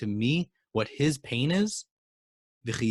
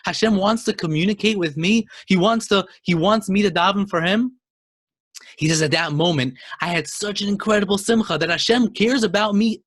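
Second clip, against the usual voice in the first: higher and louder, rising with rapture; ecstatic joy; 6 semitones higher, 6 dB louder than usual. Acted. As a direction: lift each line as the joy overflows. Nachman's joy at Hashem's closeness.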